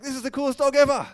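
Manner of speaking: loud, fast, high brag; thrilled. Pretend. Owner showing friends the dog's backflip, loud thrilled boast. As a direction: show off to your friends. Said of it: "You're like".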